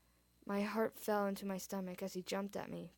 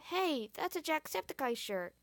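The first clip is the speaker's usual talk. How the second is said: put-on high, eager kid voice; excited exclaim; 7 semitones higher than usual. A narrator's reading. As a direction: blurt it out with excitement. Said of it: "he exclaimed".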